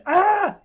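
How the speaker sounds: short, high, loud yell; scream. Retold or imitated. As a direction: let out a kid's sudden shriek. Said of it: "took his turn and screamed".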